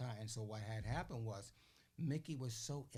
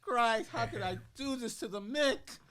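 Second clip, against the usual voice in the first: high, loud, frantic self-blame; panicked near-crying; 9 semitones higher, 10 dB louder than usual. Retold or imitated. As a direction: wail it out like a terrified kid. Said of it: "and I'm crying".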